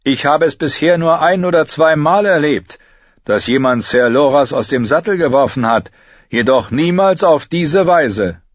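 king addressing the field; young king. A narrator's reading. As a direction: praise the victor before the crowd. King Renly voiced in the story.

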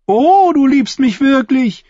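high, slow, gushing fool; mocking jester. A narrator's reading. The fool answers Timion's offer, high mocking delight. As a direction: gush mockingly in a high, drawn-out voice.

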